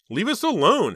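high, loud scream. Acted. Heather screams at her uncle to leave them alone. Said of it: "Heather started to scream".

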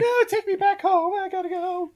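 put-on high, loud girlish whine; imitating a frantic passenger; mock panicked plea. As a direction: plead loudly in hammed-up panic. Acting out girl's panicked plea.

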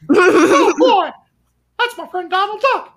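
very high, loud squeaky cartoon mouse; Mickey Mouse voice. Streamer answering a chatter, high loud Mickey squeak. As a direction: squeak it out like a cheery cartoon mouse, half-joking. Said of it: "I could do a half-ass Mickey".

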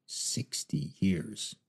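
soft, slow murmur to himself; quiet to himself. A narrator's reading. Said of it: "added a quiet".

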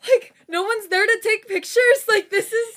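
high, loud, flustered panic; stressed rant. Real, not acted. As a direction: vent in a stressed rush. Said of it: "I'm genuinely stressed right now".

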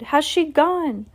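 high, slow, scared call; boy in a tree, slow and frightened, calling down; fearful shout. A narrator's reading. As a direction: stretch the question out in fear. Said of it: "I shouted".

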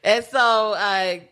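usual speaking voice

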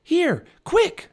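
loud, high-pitched urging; high, excited little-girl cry; urgent calling. A narrator's reading. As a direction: call out frantically while fleeing. Annie urging Jack while fleeing.

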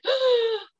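sharp, high gasp of shock; mock shocked gasp. Witness imitating the group seeing smoke congeal, shocked gasp. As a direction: imitate a frightened intake of breath.

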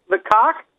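"cock" rising, unsure of the answer; said as a question. Retold or imitated. Caller asked for favorite station, "cock" rising in confusion. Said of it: "they always say it with, like, a question".

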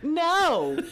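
high, loud mock scolding; drawn-out retold parental disbelief; appalled parent. Parent refusing child's slangy melatonin request, mock appalled.

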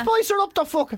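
high-pitched egging-on mates; mock single mates. Single mates egging him on, mock high voice. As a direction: pitch it right up as the cheering mates. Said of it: "your single mates are there".

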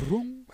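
"Wrong" with a long trilled R; tongue-rolled R. Shown as a demonstration. Showing off for friends, "Wrong" with rolled R. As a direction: trill the R hard at the start of "Wrong". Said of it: "super tongue roll".

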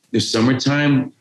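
normal talking voice

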